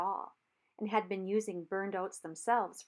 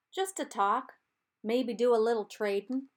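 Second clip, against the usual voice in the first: calling across; 3 semitones higher, 6 dB louder than usual. A narrator's reading. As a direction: call it over the water, easygoing. Reb calls across the river.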